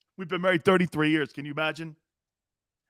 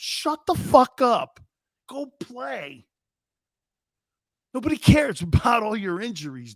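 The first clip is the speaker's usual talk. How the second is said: angry; 5 semitones higher than usual. Real, not acted. Angry rant at quarterback.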